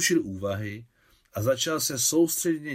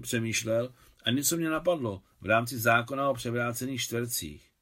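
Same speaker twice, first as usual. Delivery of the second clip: ordinary delivery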